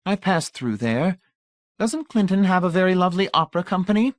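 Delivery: high, simpering society lady; fatuous matron coo. A narrator's reading. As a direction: coo it with empty delight. Mrs Pritchett voiced.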